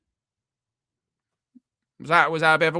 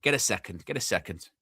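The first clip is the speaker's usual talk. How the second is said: normal talking voice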